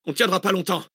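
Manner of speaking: high, desperate cry. A narrator's reading. Driver under fire on a forest road, crying out. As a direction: call out in alarm, pitched high. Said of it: "cria Zach".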